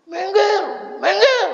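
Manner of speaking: high, loud scolding shout; angry shout. Retold or imitated. Husband yelling at mourners to get away. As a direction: shove them back with your voice. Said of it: "Semua diomelin".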